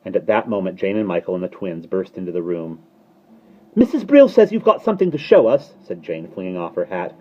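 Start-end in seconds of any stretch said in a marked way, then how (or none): 3.7-5.8 put-on high, loud child's voice; excited little girl pipe; eager child. A narrator's reading. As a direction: burst in with big news.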